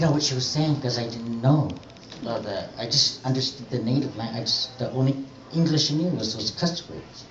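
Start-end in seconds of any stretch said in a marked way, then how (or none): none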